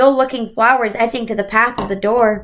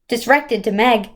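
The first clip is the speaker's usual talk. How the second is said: ordinary delivery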